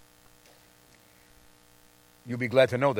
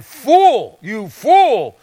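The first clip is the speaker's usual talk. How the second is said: loud, high demonstrated insult; mock insult; 5 semitones higher, 8 dB louder, much slower than usual. Preacher demonstrating the insult fool, loud and high. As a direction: fling the word fool. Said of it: "And then".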